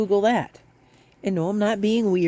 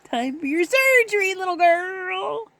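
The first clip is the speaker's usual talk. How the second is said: high, slow mock-sinister teasing; teasing kitten; 12 semitones higher, much slower than usual. Owner teasing her kitten about the vet. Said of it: "I'm like".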